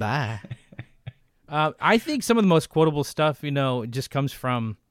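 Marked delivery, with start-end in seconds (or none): 0.0-0.4 low, softer one-word movie quote; Powers Booth. Retold or imitated. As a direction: echo the line lower, as an imitation. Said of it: "That was a perfect Powers Booth".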